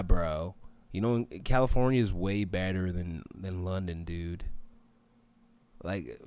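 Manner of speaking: mock California bro accent. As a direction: put on a slow Californian surfer-dude drawl. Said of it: "Let me do an accent for, like".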